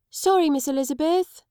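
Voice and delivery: put-on high, rising child apology; a scolded little boy; small boy's shy sorry; sheepish boy.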